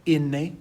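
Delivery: "inne" as "EN-neh", rising at the end; Spanish letter name. Shown as a demonstration. Teacher modelling Spanish N for students, "inne" pronounced clearly. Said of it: "which is pronounced".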